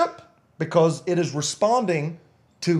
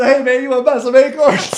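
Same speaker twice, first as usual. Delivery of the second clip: fast, loud hustler pitch; shady tree hustler; 9 semitones higher, 10 dB louder, faster than usual. Retold or imitated. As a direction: sell acorns like a street hustler. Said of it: "A tree hustling says".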